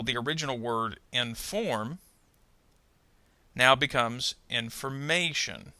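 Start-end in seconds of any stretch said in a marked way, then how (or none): 1.1-2.0 "inform" with weak in, strong FORM; second-syllable stress. Shown as a demonstration. Teacher shows the original word, "inform" stressed on FORM. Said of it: "notice how the stress will move". 4.5-5.7 "information" with the main stress on MA; stress before suffix. Shown as a demonstration. Teacher shows how stress shifts, "information" stressed on MA. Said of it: "moves to the syllable before".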